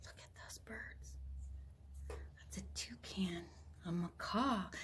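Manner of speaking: gasped, whispered aside. Real, not acted. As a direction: whisper in awe at the birds. Awed aside over picture.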